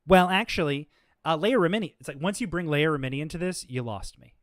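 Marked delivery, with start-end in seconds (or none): 0.1-1.9 mock smug well actually. Mocking opponent's smug point.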